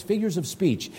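ordinary talk, nothing marked

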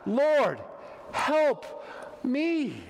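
high, drawn-out cry for help; acted heartfelt appeal to God; simple plea. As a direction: plead word by word, with pauses. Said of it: "the most simplest of plea".

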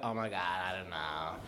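put-on soft, low valley-girl drone. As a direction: trail it out like an understated valley girl. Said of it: "I just always sound like a valley girl".

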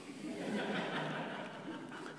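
quiet, breathy chuckle; soft laugh. Real, not acted. Speaker amused by granddad's second thoughts, soft laugh. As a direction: laugh softly under the breath.

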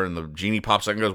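ordinary delivery

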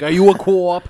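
loud mock Boston accent. Quoting a Super Bowl commercial, loud Boston accent. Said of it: "I love Bostonian accents".

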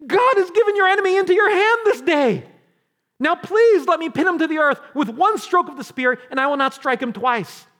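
loud, high-pitched, urgent pleading; Abishai's urgent plea. Acted. Abishai urging David to let him strike, loud and urgent. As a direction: cry it out at full voice. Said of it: "Then Abishai said to David".

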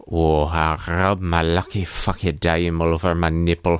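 low, hammed-up Scottish brogue; Scrooge. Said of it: "giving himself a pep talk in the mirror".

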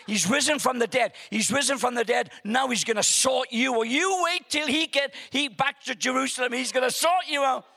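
loud, high, excited crowing; triumphant shouting. Retold or imitated. Moviegoer warning baddies that Jesus has risen, triumphant. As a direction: crow a gleeful threat at full voice. Gloating at baddies, acted film reaction.

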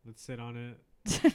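slow drawn-out. Pretend. Joking slow twist on line.

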